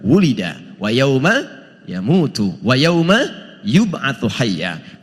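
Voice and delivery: slow, melodic chanted recitation of a Quran verse. Acted. Preacher recites the verse to the congregation, slow melodic chant. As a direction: draw each Arabic phrase out in a recitation tune.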